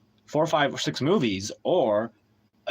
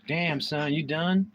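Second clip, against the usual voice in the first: voicing the cat; 3 semitones higher than usual. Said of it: "Look at Cat. He's like".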